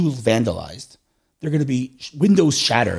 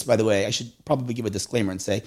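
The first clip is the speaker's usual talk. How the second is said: ordinary delivery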